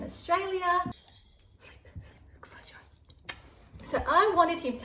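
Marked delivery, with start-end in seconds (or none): 1.6-3.4 whisper. Real, not acted. Whispering the puppy to sleep.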